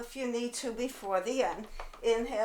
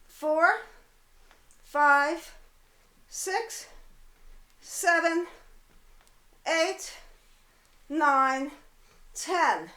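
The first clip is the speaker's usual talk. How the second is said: pushing coach count; 5 semitones higher, 4 dB louder, much slower than usual. Real, not acted. Coach pushing through last reps.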